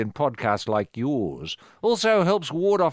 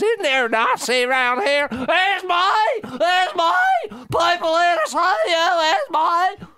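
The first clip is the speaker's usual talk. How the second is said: much higher, louder shouted call; 3 dB louder, slower than usual. Pretend. Neighbour calling Esme outside to meet tourists, loud high shout. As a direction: holler down the street for her.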